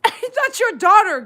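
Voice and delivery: high, loud cry of mock outrage; amused scolding. Real, not acted. Owner to mother dog pestering her daughter, scolding. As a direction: call the name out, then drag out the reproach.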